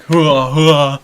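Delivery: loud exerted hua noises; imitated wrestler huffs. Mimicking Rocky hopping like a yoga instructor, loud huffs. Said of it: "He was like".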